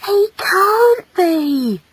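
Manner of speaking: very slow, high wail of disbelief; horrified motherly lament; dismayed mother. Acted. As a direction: draw it out high, refusing to believe. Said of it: "she whispered".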